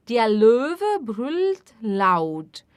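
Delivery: very slow, clear and even; slow model sentence. Shown as a demonstration. Teacher modelling sentence slowly for repetition. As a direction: spell it out slowly for learners. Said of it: "teacher models sentence".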